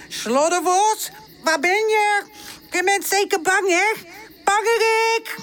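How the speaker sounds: high, teasing calls of a cocky pup; high sing-song mockery from a small dog; puppy taunt. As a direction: goad the fox to come out. Charlie taunts the hiding fox.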